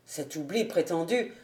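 hint of a male character, low and soft; understated low officer's voice; captain's voice. A narrator's reading. Captain's line in read dialogue.

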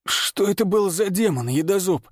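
hoarse, strained and pitched high. A narrator's reading. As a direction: force it out hoarsely through pain. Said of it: "Хрипло выдавил он".

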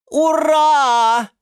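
loud, very high drawn-out cheer; joyful shout. Acted. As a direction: cheer at the top of your voice. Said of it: "закричала сверху орхидея Галатея". (радостный крик)

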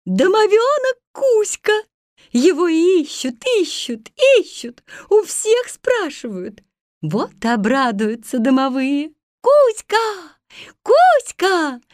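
excited calling. Acted. Mermaid calls out excitedly.

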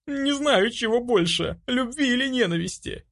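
high, anguished outburst; desperate cry. A narrator's reading. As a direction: cry out helplessly, pitched very high. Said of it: "закричала я".

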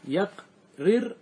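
يقررن split slowly into "yaq, rir, na"; syllable by syllable. Shown as a demonstration. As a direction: pause between each syllable of يقررن. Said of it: "ها، إيش فعلت".

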